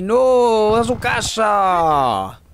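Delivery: loud victorious outburst; triumphant shout. Real, not acted. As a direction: shout it like a knockout blow. Reacción al matar enemigos. (grito triunfal y fuerte)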